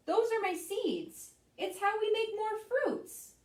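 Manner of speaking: high, bright, eager explaining; proud tomato. Acted. Tomato explains his seeds to the vegetables, excited. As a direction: share the secret with pride. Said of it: "says the tomato".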